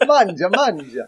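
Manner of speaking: Italian bird urging food on you; Italian corella. Acted. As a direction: put on a hammy Italian accent. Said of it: "an Italian corella would be".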